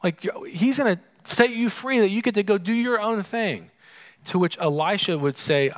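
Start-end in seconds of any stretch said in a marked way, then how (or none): 0.0-3.7 higher, teasing voice of the sons of the prophets; mock them, luring and sly; mocking tempters. Sons of the prophets tempting Elisha, higher and mocking. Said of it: "they're coming out to say".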